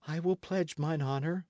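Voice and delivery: high, restrained answer; a hint of a boy standing his ground; lightly voiced youth. Lad answering the angry knight, high and restrained.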